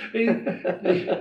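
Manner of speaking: high breathy laugh breaking through; real laughter. Real, not acted. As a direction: laugh at your own punchline. Laughs at end of punchline.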